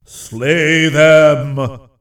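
booming voice of the forest trees; thunderous telepathic voice. Acted. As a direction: proclaim it like an ancient forest god. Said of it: "thunderous throbbed the strange telepathic voices".

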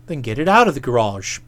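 deep, gruff order; low Boko voice. Acted. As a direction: drop low and command it. Boko voiced low after Bertie.

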